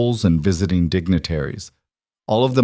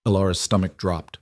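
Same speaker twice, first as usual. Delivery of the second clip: usual speaking voice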